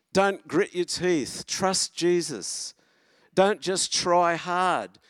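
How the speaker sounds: rally the congregation with passion; fervent preaching. Acted. Pastor urging his church not to give up, fervently preaching.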